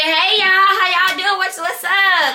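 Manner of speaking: high, lively hello; upbeat Southern hello to the table; high-spirited greeting. Retold or imitated. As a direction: breeze in full of cheer. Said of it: "I literally walked in high spirits".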